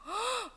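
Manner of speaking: sharp audible intake of breath; storyteller's gasp. Pretend. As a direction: gasp for the children. Reacting for the children to the story. (헉 하고 숨 들이쉬기)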